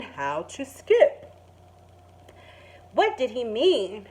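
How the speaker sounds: gentle storybook narration; calm picture-book reading voice; reading to children. Acted. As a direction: read it like story time for kids. Teacher reads picture book aloud.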